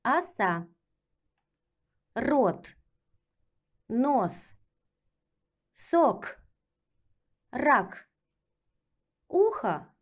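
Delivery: each Russian word said clearly and slowly; teacher drill reading; slow careful reading. Shown as a demonstration. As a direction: model the word for students to repeat. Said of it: "let's practice with reading".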